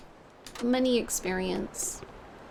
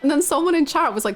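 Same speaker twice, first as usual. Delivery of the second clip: ordinary talk, nothing marked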